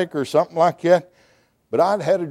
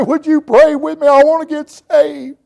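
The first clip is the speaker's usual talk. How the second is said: mock crying plea; 11 semitones higher, 4 dB louder than usual. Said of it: "blubbering like a baby".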